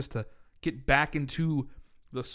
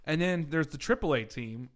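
ordinary talk

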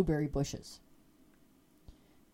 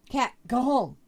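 louder and higher, firm call of "home"; louder shooing call; 5 semitones higher, 7 dB louder than usual. Retold or imitated. To the neighbor's cat in her yard, a louder firm shoo.

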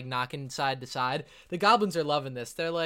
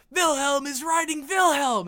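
high, loud, slow goblin whoop; goblin crowd cheer; 12 semitones higher, 7 dB louder than usual. Acted. Goblin spectators cheering as Wilhelm rides, high loud whoop.

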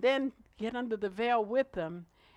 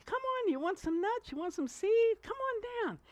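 higher, sweet enticing call; coaxing decoy bird; 12 semitones higher than usual. Pretend. Trained bird coaxing wild birds down, high sweet coaxing. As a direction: lure the other birds down with treats. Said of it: "that trained bird would go".

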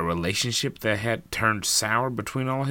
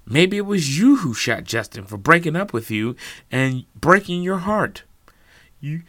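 heated accusation; 6 semitones higher, 5 dB louder than usual. Acted. Norman accuses Lisa.

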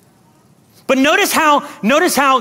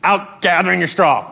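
ordinary talk

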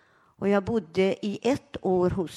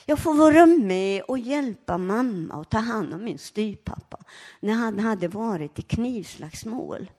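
ordinary talk, nothing marked

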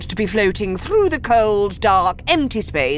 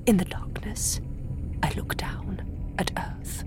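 soft unvoiced whisper; 5 dB quieter than usual. A narrator's reading. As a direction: hush it like a secret. Whispered narration.

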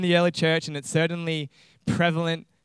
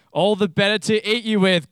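loud, raised wolf growl; 5 semitones higher, 5 dB louder than usual. Acted. Wolf drops the disguise to eat the girl, loud growl. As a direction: growl it louder, pouncing on the prey. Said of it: "growled the wolf".

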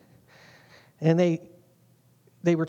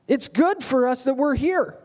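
higher mock-eager voice, leaning on so lucky; overeager Peter; 5 semitones higher than usual. Peter tells Jesus how lucky he is, higher and mock eager. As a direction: play Peter puffed up and pleased with himself. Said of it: "he goes".

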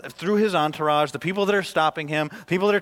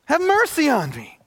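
higher, louder, slow plea; yelling plea; 12 semitones higher, 6 dB louder than usual. Retold or imitated. Blind man yells over the crowd, slow loud plea. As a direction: shout the plea over the noise. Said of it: "yell over these people now".

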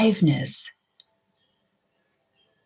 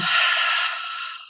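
long unvoiced exhale sigh; audible sigh. Shown as a demonstration. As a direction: let the breath fall out in a sigh. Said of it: "with an audible sigh".

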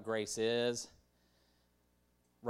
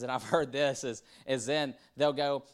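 usual speaking voice

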